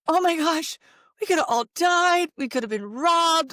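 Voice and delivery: a hammed-up panicked mom; half-joking alarm at the open garage door; mock panic. Retold panic over garage door.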